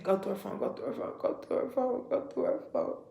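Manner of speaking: hushed, tempting whisper repeating itself; inner voice whisper. Retold or imitated. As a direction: whisper it like a voice in your head urging you on. Voice urging her to snoop.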